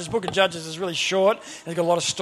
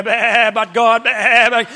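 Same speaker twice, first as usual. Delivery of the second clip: loud bleating speech impediment; sheep-bleat stammer; 8 dB louder, much slower than usual. Retold or imitated. Moses blaming the sheep for his speech, mock bleating stammer. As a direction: bleat through the words like a sheep. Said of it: "I now got a speech impediment".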